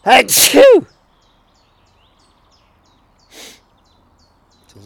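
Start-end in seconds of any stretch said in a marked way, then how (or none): 0.1-0.9 even bigger, higher sneeze; a second explosive sneeze; loud sneeze. Real, not acted. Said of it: "I'm going to sneeze".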